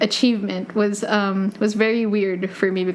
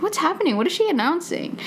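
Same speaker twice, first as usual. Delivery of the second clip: confused surprise; 8 semitones higher, faster than usual. Retold or imitated. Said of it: "And I was just like".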